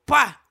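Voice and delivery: sudden loud "puá" sound effect; imitated burst noise. Actor showing how she popped out onstage, imitated burst noise. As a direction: punch out the noise of doors bursting open.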